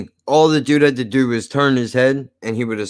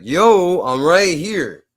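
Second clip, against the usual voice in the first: retold yell; 6 semitones higher, slower than usual. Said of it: "I yelled to the car".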